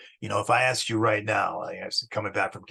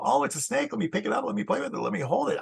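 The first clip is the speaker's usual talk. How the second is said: much higher, bright eager voice; eager delight; 10 semitones higher, faster than usual. Pretend. Teacher imitating a snake-loving student, eager and high. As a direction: gush like a snake fan spotting one. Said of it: "you might go".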